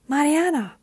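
high, loud call of a name. A narrator's reading. Jessica calls from behind the rocks, calling out. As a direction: call to someone at a distance. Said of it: "She was calling out to her".

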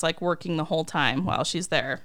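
ordinary talk

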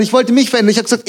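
normal talking voice

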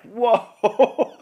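high, loud laughing whoa; mock shocked whoa. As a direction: blurt it out like the calorie count just hit you. Reacting to calories on the board.

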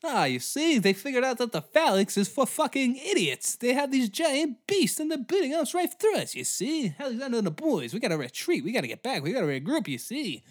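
high 1940s movie villain voice; old-timey villain. Retold or imitated. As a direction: sneer each "you see" like a film baddie. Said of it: "I love that voice".

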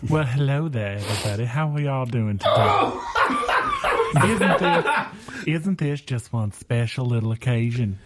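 a hint of a Southern belle greeting the court. Southern belle greets the courtroom with sweet Southern accent. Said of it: "talk like a Southern belle".

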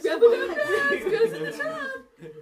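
laughing mock. Player mocking, laughing.

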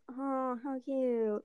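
soft, drawn-out onlooker coo; imitated cooing. Repeats friends' cooing reaction.

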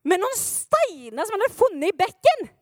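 astonished exclamation; incredulous outburst. Acted. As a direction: cry it out as if it were impossible. Preacher acts stunned at the outcome.

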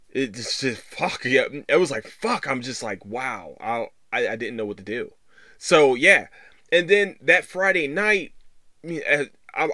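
ordinary delivery

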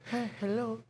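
faint breathy imitation of a sick greeting; mimicking how he spoke while ill; weak sick voice. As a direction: barely voice the hello. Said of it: "this is how we talk".

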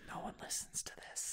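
breathy conspiratorial whisper. Real, not acted. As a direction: lean in and whisper the aside. Aside joke to co-host.

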